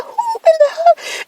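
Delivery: very high, squeaky, disbelieving; who-in-the-hell indignation; outraged. Retold or imitated. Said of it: "And I said".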